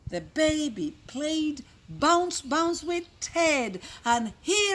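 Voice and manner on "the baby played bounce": plain reading voice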